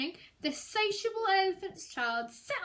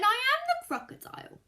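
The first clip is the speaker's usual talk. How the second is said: high, fast, louder mock crocodile; 9 semitones higher, 4 dB louder, faster than usual. Crocodile introducing himself to the child, hammed-up. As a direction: announce it with sly glee. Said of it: "The crocodile said".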